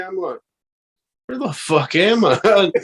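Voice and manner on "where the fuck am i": slow, higher, disbelieving; mock bewilderment. Stunned tourist after cops greet him, slow disbelief. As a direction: drag it out like you can't believe it.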